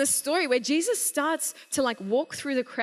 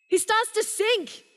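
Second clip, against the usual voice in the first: panicked cry, much higher and louder; freaking out; 8 semitones higher, 5 dB louder than usual. Retold or imitated. Preacher voices Peter sinking and crying out to Jesus, panicked yell. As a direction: yell it as you sink in panic. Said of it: "He freaked out".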